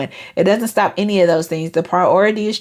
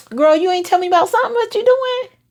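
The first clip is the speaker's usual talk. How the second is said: playful surprise; 13 semitones higher than usual. Retold or imitated. Playing a curious friend.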